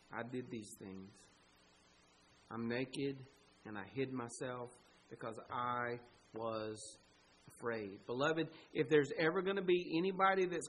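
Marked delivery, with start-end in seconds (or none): 0.1-8.1 low, hushed admission in halting phrases; subdued confession. Acted. Adam owning up to God, low and subdued. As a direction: confess softly, voice sunk low.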